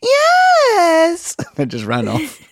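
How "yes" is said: very high, loud squealed yes; toddler shout. Retold or imitated. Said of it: "And I went".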